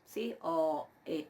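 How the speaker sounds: usual speaking voice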